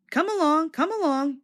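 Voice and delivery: high, hurried fussing; bossy little engine fussing. Acted. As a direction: chivvy the coaches along fussily. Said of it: "he fussed".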